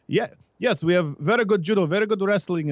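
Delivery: put-on Bosnian accent; imitated Bosnian accent. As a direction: mimic a Bosnian lifter's accent. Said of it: "talking with a Bosnian guy at the gym".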